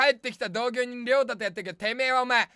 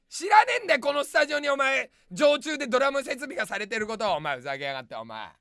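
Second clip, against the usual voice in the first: ordinary delivery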